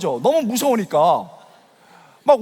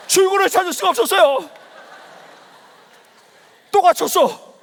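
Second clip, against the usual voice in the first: high, strained cry of dismay; exaggerated panic; 9 semitones higher, 5 dB louder than usual. Preacher recounting lights out and no exit, panicked. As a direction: relive being trapped, hammed-up. Trapped when lights went out.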